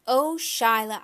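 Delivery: loud calling shout. A narrator's reading. Boy shouting at a girl on his rocks, loud shout. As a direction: shout her name across the rocks. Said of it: "I shouted".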